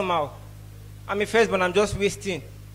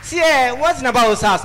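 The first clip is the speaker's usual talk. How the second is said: excited build-up; 4 semitones higher, 6 dB louder than usual. Real, not acted. Comic launching tribute loudly.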